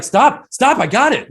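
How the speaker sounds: high, loud mock snap of a fed-up wife; mock snapping wife. Wife snapping at husband over dishwasher advice, high loud mock snap. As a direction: throw it back at him like a hammed-up annoyed wife.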